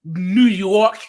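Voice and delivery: New York with the R dropped; East Coast accent. Shown as a demonstration. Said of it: "instead of New Yorkers".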